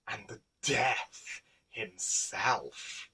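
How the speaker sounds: plain reading voice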